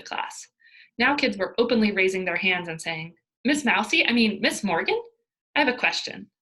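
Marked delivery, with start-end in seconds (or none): 3.4-6.3 teasing. Acted. Kids mocking the teacher.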